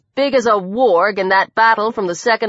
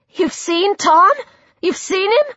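high, insistent questions rising at the end; stunned, high-pitched questioning; urgent astonishment; 10 semitones higher than usual. A narrator's reading. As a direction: ask with amazed urgency. Astonished girl asks after Tom.

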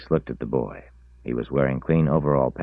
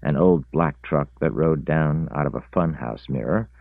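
plain reading voice

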